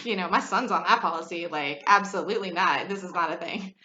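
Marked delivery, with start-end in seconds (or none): none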